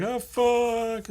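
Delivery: high drawn-out mock wail; dismayed giant shark. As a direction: cry out as the defeated shark. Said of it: "the giant shark's like".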